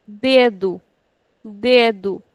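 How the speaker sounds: dedo with DE stretched and do short; stretched first syllable. Shown as a demonstration. Teacher models dedo for students, stretching the first syllable. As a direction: hold de in dedo, clip the ending. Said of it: "We are stretching this and shortening this".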